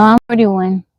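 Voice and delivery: ordinary delivery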